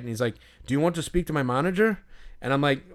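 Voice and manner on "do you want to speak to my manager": quick, higher Irish offer; thick Irish accent. Retold or imitated. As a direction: imitate an Irish attendant asking briskly. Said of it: "this thick Irish accent".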